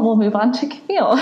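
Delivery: tearful laugh through emotion. Real, not acted. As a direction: laugh it off before tearing up. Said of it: "Before I get really, um, emotional".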